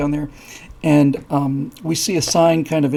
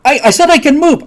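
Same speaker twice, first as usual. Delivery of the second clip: much higher and louder, as if ears are plugged; oblivious shouting; 11 semitones higher, 9 dB louder than usual. Acted. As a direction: shout it, unable to hear yourself. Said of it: "I'll be shouting 'cause I can't hear".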